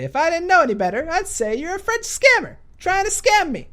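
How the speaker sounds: loud, very high hammed-up outburst; mock accusation. Vlogger accusing the French scammer, hammed-up high yell.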